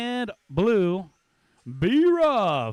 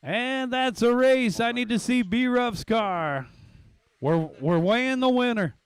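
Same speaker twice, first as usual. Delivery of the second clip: fast excited race call; play-by-play; 5 semitones higher than usual. Acted.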